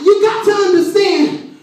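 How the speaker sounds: high-pitched, impassioned sermon shout; full-force preaching. Real, not acted. Preacher promises life in a dead situation, full-force preaching. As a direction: drive it home like a preacher at full cry.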